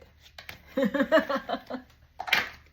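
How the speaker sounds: high, loud mischievous laugh; loud teasing laugh. Real, not acted. Reader teasing viewers they might be the devil, loud laugh. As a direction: laugh at your own tease.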